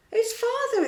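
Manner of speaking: high, loud gasp of surprise; exclaiming. A narrator's reading. Wendy recognising her father, exclaiming in surprise. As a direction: burst out with the realisation. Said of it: "exclaimed Wendy".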